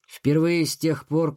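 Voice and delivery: ordinary delivery, nothing marked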